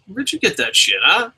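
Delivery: usual speaking voice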